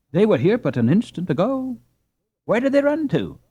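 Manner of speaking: high bewildered asking; puzzled mother. Acted. Mother asking Hans where the girls ran, puzzled. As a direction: wonder aloud where the girls went.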